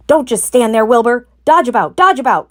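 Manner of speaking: loud urgent orders, pitched high; crying out. A narrator's reading. Goose ordering Wilbur to dodge, loud. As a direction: bark commands at the pig. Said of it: "cried the goose".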